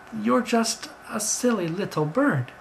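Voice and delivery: slow, high-pitched belittling. Acted. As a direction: draw it out to belittle the bird. Roger dismisses the bird.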